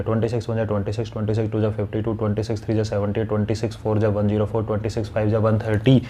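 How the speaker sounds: quick, soft, even recitation from memory; times-table recitation. Shown as a demonstration. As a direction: run through the table quietly, keeping it low and steady. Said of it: "फिर वापस बोलना चालू किया".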